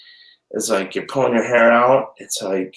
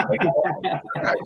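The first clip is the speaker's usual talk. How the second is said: breathy laugh through the words; 12 semitones higher, 4 dB quieter than usual. Real, not acted. Mom laughing at her own daughter bragging. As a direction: laugh it out, much higher.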